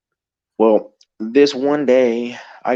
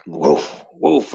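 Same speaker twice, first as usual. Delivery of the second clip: storyteller's big-dog woof; imitated dog bark; 4 semitones lower than usual. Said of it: "here comes the woof".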